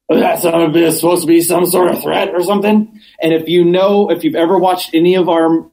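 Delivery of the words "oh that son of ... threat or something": quick, wrecked-voice mimicry; ruined-voice impression. Councilman turns to answer the interrupter, in a ruined voice. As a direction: imitate someone who has ruined their voice. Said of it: "he has this terrible, "I've ruined my voice," voice".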